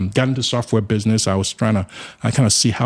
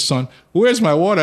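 a much higher, louder mock mother demanding her water; mama demanding; 9 semitones higher, 4 dB louder than usual. A hint of mama scolding her son about the missing water. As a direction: lightly play mama asking where her water went. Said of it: "my mama looked at me and said".

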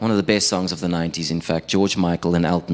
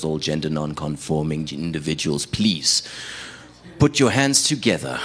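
lightly voiced manly stage announcer; manliest manly voice; 3 semitones lower than usual. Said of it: "this manliest manly voice was like".

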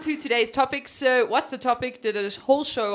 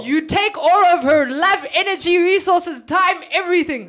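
louder playful accusation; 5 semitones higher, 6 dB louder than usual. Real, not acted. Mock accusation, building.

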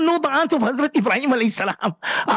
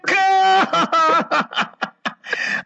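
laughing; 7 semitones higher than usual. Real, not acted. Host laughs after wrong answer.

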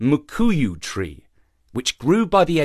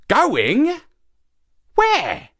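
high, rising, astonished questions; great surprise; 3 dB louder than usual. A narrator's reading. Monkey pokes head out to question shark, great surprise. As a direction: squeak it upward in disbelief. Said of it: "in great surprise".

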